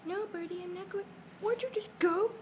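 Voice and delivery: usual speaking voice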